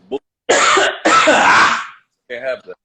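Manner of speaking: choking on a drink; coughing mid-word, voice strained; coughing up water. Real, not acted. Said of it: "that went down the wrong pipe".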